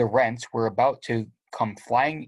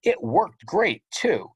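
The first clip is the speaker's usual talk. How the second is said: sarcastic; 10 semitones higher, slower than usual. Acted. Sarcastic confession.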